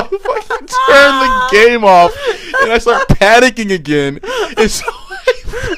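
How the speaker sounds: cracking up so hard the words come out slowly; laughing hard. Real, not acted. Laughing fit mid-story.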